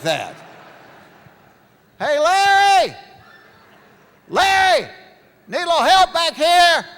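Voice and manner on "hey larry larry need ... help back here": high, loud yell to a friend; shouting for help. Retold or imitated. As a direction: call out from the bathroom for rescue. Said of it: "leave you hung up".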